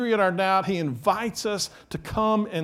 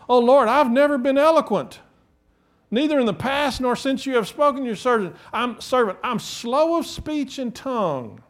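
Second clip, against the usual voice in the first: lightly acted Moses, pitched high; protesting Moses; 6 semitones higher, 5 dB louder than usual. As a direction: plead an excuse in a raised voice. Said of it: "Here's how Moses replied".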